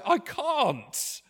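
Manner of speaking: helpless admission. Pretend. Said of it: "you will put your hands up and say".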